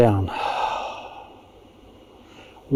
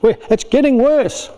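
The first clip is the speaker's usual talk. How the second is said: higher-pitched mock panic; hammed-up alarm; panicking; 6 semitones higher than usual. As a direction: play a parent who thinks it's all failing. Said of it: "'Cause you think you're failing".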